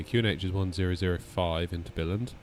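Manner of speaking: usual speaking voice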